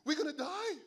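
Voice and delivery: high loud mock panic. Believers facing another Goliath, in hammed-up panic. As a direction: wail it like a cornered believer. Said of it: "another Goliath".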